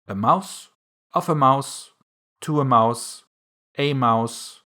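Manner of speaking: measured declension with pauses between forms; grammar recitation. A narrator's reading. Alice recalls her brother's Latin grammar, reciting by rote. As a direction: recite each case as a separate item. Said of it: "having seen in her brother's Latin grammar".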